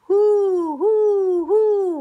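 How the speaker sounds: high, loud ghostly hoo; owl-like ghostly hooting from far away; faraway hooting. Acted. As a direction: hoot like a spook far off. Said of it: "went a faraway voice".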